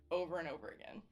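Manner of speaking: ordinary talk, nothing marked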